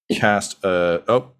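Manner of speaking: ordinary talk, nothing marked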